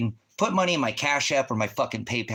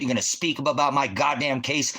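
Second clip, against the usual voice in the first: ordinary talk, nothing marked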